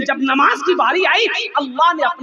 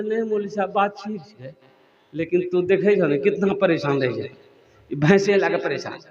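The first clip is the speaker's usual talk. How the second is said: a lightly voiced villager, softer and lower; villager's reply; 6 semitones lower, 4 dB quieter than usual. Villager answering the imam, lightly voiced and softer. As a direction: mumble it like a humble villager.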